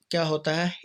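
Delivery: ordinary talk, nothing marked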